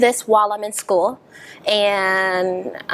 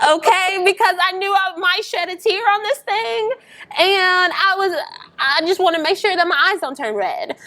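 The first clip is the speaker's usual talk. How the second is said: 1 high, amused; 9 semitones higher than usual. Real, not acted. Joking about the Visine.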